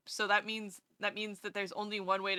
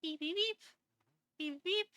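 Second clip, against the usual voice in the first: beeping; 7 semitones higher than usual. Pretend. Imitating beeps.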